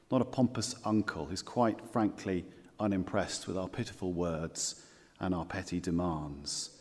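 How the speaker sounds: ordinary talk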